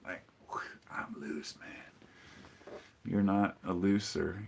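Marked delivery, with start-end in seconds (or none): 0.0-2.8 a breathy unvoiced hush, like letting go; loose, unvoiced whisper; mock loose whisper. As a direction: whisper it, all air and no voice. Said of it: "You're looser?".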